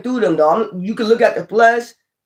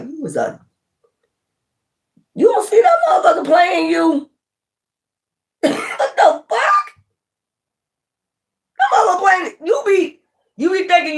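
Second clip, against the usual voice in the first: incredulous outburst; 11 semitones higher than usual. Retold or imitated. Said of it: "I'll be hearing answers like".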